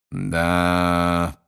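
long drawn-out, level musing word; thoughtful. A narrator's reading. Mr Roberts muses about the crowds, thoughtful. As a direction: stretch it out while pondering. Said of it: "продолжал он задумчиво".

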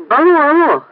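high-pitched radio operator's call; standard radio voice. A narrator's reading. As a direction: hail the base over the radio. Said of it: "стандартным радиоголосом".

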